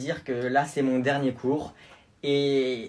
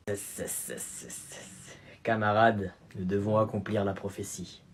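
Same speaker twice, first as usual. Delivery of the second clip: incantation grave; 6 semitones lower, 5 dB quieter, slower than usual. Acted. Élève psalmodie une prophétie.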